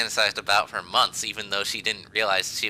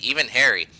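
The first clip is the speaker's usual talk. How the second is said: ordinary talk, nothing marked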